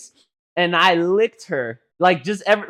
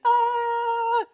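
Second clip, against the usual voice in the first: put-on much higher, a small child's panicked cry; toddler wail. Toddler running to his mom, high frightened wail. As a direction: squeal like a scared toddler. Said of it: "and he was, like, fucking".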